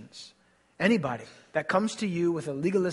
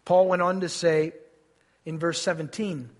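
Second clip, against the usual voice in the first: ordinary delivery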